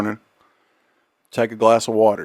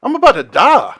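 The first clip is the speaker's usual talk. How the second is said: mock shout; 9 semitones higher, 6 dB louder than usual. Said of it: "And they're like".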